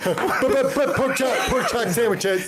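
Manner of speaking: put-on high kid voice through laughter; high voice breaking into laughs; laughing mock kid voice. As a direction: giggle out a silly kid's shout. Imitating kids, laughing.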